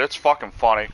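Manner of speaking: usual speaking voice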